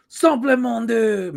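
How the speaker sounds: high, slow hammed-up catchphrase; slow, high mock announcer; gimmick catchphrase. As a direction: belt out the old show gimmick. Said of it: "this was your gimmick".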